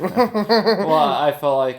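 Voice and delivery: laughing through the words; giggling as he starts talking. Real, not acted. Laughs at raccoon joke.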